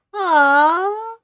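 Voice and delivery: loud, high delighted aww. Real, not acted. Reader cooing at the sunset, loud and high. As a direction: coo at the pink sunset. Reacting to blushing sky.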